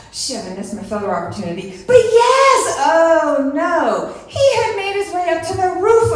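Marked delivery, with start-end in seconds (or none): 1.9-2.8 much higher, loud victory shout; triumphant yell. Retold or imitated. Celebrating the rat on her truck, loud whoop. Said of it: "I'm like". 2.8-4.1 laughing oh no. Retold or imitated. Rat climbs onto her truck.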